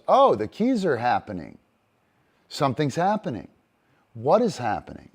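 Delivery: loud, excited dog voice getting worked up; eager dog. Retold or imitated. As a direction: voice the dog sensing something is coming. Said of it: "The dog goes".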